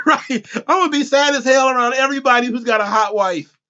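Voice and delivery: normal talking voice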